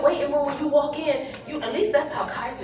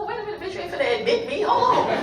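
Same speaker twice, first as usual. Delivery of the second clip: alarmed protest; 6 semitones higher, faster than usual. Retold or imitated. Stopping the nurse banding her.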